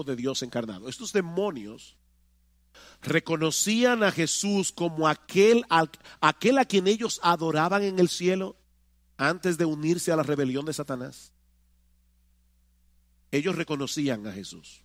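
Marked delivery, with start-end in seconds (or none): none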